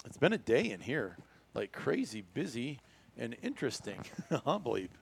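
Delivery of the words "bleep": ordinary delivery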